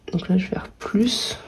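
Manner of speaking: whispered aside to herself; whispering. Real, not acted. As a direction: breathe it, no voice. Aside while writing figures.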